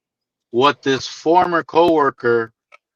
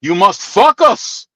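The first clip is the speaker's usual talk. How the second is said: slow, deadpan "fuck us" for focus; mock Greek accent, "fuck us" for focus; heavy Greek accent; 3 semitones higher, slower than usual. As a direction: drop the Greek coworker's line in his accent. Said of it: "That's that heavy ass accent".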